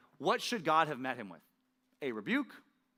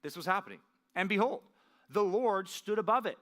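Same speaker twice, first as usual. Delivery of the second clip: amazed exclamation; 5 semitones higher than usual. Pretend. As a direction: read it like an exclamation point. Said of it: "do you like how it has an exclamation point".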